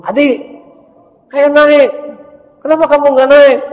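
high, loud teacher voice calling a pupil; bus teacher calling. Acted. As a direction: call the boy onto the bus from the door. Teacher calls boy onto bus.

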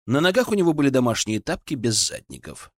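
ordinary delivery

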